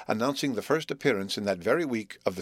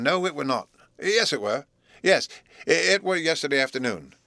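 ponderous; 5 semitones higher, 4 dB louder than usual. A narrator's reading. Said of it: "mingled wisdom, relief".

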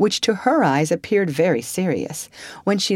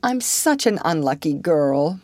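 usual speaking voice

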